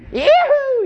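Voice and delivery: high, loud yee-hoo holler; sky-high celebratory yee-hoo; excited whoop. Pretend. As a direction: whoop it out in sudden jubilation. Acting out an excited leap over pew.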